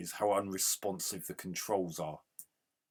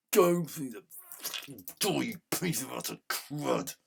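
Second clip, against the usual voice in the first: higher and louder, spitting the insult; angry shout; 5 semitones higher, 5 dB louder than usual. Real, not acted. Gamer swearing at his character stuck at the door, angry shout. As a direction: curse the sprite out.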